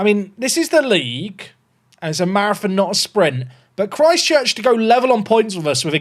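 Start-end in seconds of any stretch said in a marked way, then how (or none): none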